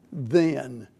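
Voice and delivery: the word "then" said low and falling; stressed then. Shown as a demonstration. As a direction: lean on "then" as the key word. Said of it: "underline the word".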